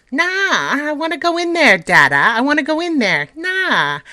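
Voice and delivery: put-on high, loud whiny child wailing; squeaking little child. A narrator's reading. Spoilt child at the door begging to go in, high squeaky whine. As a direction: whine like a spoilt brat demanding entry. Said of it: "a squeaking little voice".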